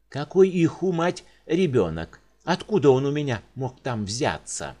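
patient shouting at the doctor, furious; outraged shout. Acted. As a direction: bellow at the doctor in disbelief.

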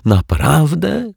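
high, drawn-out, delighted "Naprawdę?"; overjoyed cry. A narrator's reading. Client hearing he is healthy, overjoyed slow cry. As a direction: cry out in sudden joy. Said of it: "krzyknął uradowany".

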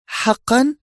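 very high woman's question; surprised. Acted. Zosha amazed at Yuri's praise, very high. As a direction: gasp it out amazed. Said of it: "قالت في دهشة".

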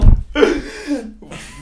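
a loud, high gasp of horror; stunned gasp at the fatal shot; sharp shocked gasp. Retold or imitated. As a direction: suck in air as if struck. Player's reaction to the shot.

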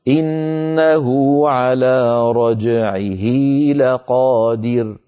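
slow chanted tajweed recitation; Quran recitation. Shown as a demonstration. Teacher reciting the verse to students: slow Quranic chant. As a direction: recite it, drawing out each vowel. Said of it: "المثال القرآني الذي أمامنا".

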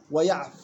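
ويعف ended on a vowelless fa, "waya'f"; stopping form. Shown as a demonstration. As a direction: stop dead on the f of ويعف. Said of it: "وقفا". (ويعف بإسكان الفاء وقفًا)

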